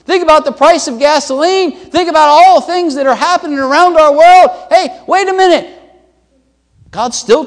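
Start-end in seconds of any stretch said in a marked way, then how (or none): 0.0-5.8 high, louder preaching in a rush of challenges; impassioned preaching. Acted. Preacher challenging church about the economy, impassioned.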